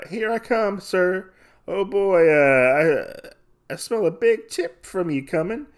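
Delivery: high, eager put-on voice; imitated serving girl. Serving girl hoping for a big tip, high eager voice.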